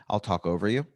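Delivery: ordinary delivery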